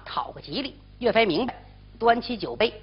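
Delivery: plain reading voice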